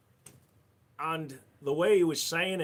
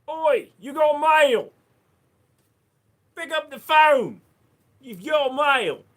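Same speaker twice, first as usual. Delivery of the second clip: mock notification shout; 13 semitones higher, 12 dB louder, slower than usual. Voicing a phone alert.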